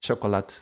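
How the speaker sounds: chocolate dropped softly at the t; chocolate with silent e. Shown as a demonstration. Showing the weak Portuguese ending, chocolate without e. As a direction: drop the e from chocolate. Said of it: "the E is already non-existent".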